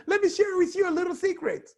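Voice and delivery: chirpy high, rushed announcement; squeaky quick blurt; high fast tell-all; wrong way, high and fast. Shown as a demonstration. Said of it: "when you're sharing secret, you won't tell people".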